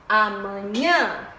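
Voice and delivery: "amanhã" slow, landing on NHÃ; stressed syllable. Shown as a demonstration. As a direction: hit the last syllable of "amanhã". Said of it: "tem mais intensidade".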